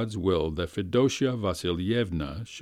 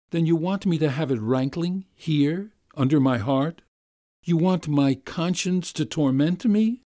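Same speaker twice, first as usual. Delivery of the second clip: higher, anguished plea; pleading gentleman; 7 semitones higher, 4 dB louder than usual. Acted. Master begging the governess, higher and anguished. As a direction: beg her to spare his conscience.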